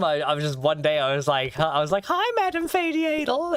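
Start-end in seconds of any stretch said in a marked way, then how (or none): 2.1-3.5 much higher, playful silly hello; teasing high-pitched hello to a friend; mock sing-song greeting. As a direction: squeak the greeting like a joke title. Retold greeting, acted out.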